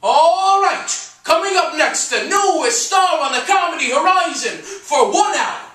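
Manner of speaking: loud, high nightclub emcee; big press-agent announcer shout; screamed stage announcement. Acted. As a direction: bellow the act's introduction to the whole club. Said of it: "he screamed".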